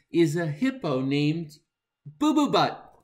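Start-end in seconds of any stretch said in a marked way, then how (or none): 2.0-2.9 loud high blurt; blurted silly name. Acted. Reader forced to say a rude name, loud. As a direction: shout the embarrassing name.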